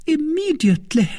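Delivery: high, sharp eager cry; Milady hungry for vengeance, eager cry; eager outcry. A narrator's reading. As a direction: blurt it out impatiently. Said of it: "Milady was about to cry out".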